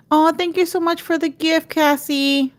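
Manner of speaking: light up at the gift; delighted thanks. Real, not acted. Thanking viewer Cassie for a gift, delighted.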